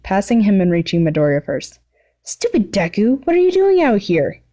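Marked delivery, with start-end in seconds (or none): 2.3-4.4 high, rough scolding call; not so quietly. Acted. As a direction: bark at him, half worried. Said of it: "he asked not so quietly".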